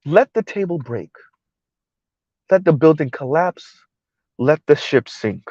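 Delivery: soft, measured mock solemnity; imitated quiet tantrum. Commentator imitating the other young lady's quiet tantrum, softly mocking. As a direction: mimic her, dropping the volume on each phrase. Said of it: "because she speaks like that".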